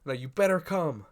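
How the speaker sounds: put-on high, loud eager boy; mock-earnest invite, pitched high; Denji's invitation voiced. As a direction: beam it out like a hopeful kid. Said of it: "he's like".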